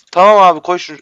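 ordinary talk, nothing marked

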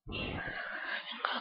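unvoiced sneaky whisper. Real, not acted. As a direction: whisper as if hiding. Whispering while sneaking in game.

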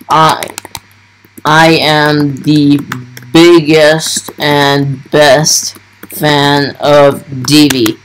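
slow, drawn-out solemn vow; halting, emotional fan declaration; dramatic declaration. Real, not acted. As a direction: proclaim it slowly like a sacred oath.

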